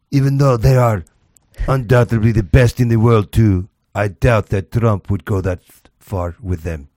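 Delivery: hammed-up Russian accent; mock Russian statesman, heavy accent; Putin. Host reading out Putin's boast, mock Russian accent. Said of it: "Mister Putin also boasted".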